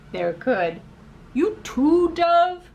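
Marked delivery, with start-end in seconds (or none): 1.3-2.7 loud exasperated you too; dismayed outcry. Acted. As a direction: cry out in disbelief. Crow dismayed at dove.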